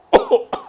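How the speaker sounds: sharp choking cough. Real, not acted. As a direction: cough hard on a drink gone down wrong. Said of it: "I'm choking on my drink".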